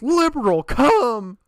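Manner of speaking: loud, high-pitched re-enacted yell; hammed-up panicked shout; retold yell. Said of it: "and I just yelled".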